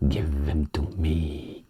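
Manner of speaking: slow, greedy whisper; a playful unvoiced whisper; whispered joke. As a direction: breathe it like you covet them. Joking about wanting the glasses.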